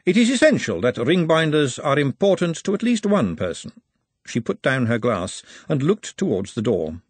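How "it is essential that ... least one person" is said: Lady Margalotta. A narrator's reading. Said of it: "said Lady Margalotta".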